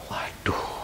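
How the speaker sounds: voiceless waduh; whisper-soft dismay. Retold or imitated. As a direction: breathe it out aghast. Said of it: "exclaim in dismay".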